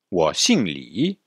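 wo xing Li said at half speed; slow model phrase. Shown as a demonstration. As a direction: spell out wo xing Li slowly for students. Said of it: "ça c'est bon aussi". (wo xing Li, très lentement)

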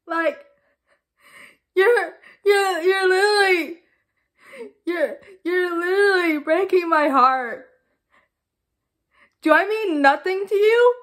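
tearful melodramatic pleading; tragic heroine sorrow. Acted. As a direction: sob it out like a soap heroine. Melodramatic breakup, crying.

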